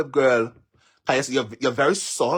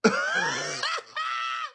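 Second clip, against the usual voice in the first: real high squealing laughter; helpless high-pitched cackle; shrieking laugh; 4 dB quieter than usual. Real, not acted. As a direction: burst out in a piercing laugh. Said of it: "right now I'm laughing at you".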